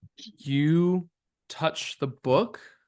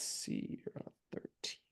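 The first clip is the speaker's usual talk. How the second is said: under the breath, unvoiced; whispered to self; 18 dB quieter than usual. Real, not acted. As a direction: mutter it to yourself. GM muttering to self.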